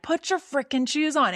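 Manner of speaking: high, mock yell at a child; retold yell. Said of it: "and I yelled at her".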